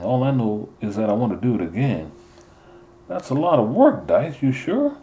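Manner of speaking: put-on curious kids after practice, wide-eyed; young boys talking. Boys' dialogue voiced higher.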